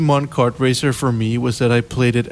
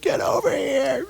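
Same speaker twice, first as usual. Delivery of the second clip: mom yelling; 13 semitones higher, 5 dB quieter than usual. Retold or imitated.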